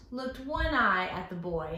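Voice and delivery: ordinary delivery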